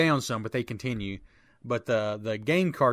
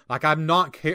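usual speaking voice

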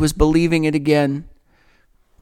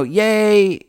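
mild retold yay; 7 semitones higher than usual.

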